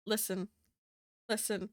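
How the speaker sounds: a hint of Aussie vowels on Listen; Listen, said with a light Australian lilt; slipping Australian accent. Real, not acted. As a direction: Kiwi slipping into mild Aussie. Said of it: "the Oceania in me just came out".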